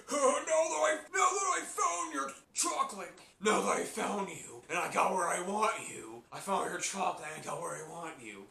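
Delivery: high, menacing voice with a laugh; creepy chocolate guy. Retold or imitated. Fan voicing the chocolate guy cornering SpongeBob, menacing. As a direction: toy with your prey. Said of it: "the chocolate, like".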